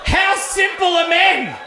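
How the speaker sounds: high and loud, laughing through it; laughing at how simple men are; laughing disbelief. Real, not acted. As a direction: crack up in disbelief.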